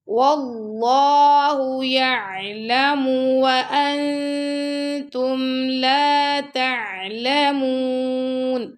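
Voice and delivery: very slow melodic chant; Quran recitation. Shown as a demonstration. Teacher recites for pupils, slow melodic chant. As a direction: stretch the vowels in recitation. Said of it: "teacher recites full verse".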